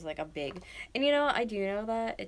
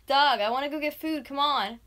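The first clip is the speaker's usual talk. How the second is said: loud, high-pitched pleading; yelling; 7 semitones higher, 9 dB louder than usual. Real, not acted. Wanting food, waking boyfriend, yelling. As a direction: yell to coax him out of bed. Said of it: "I yell at him like that to get up".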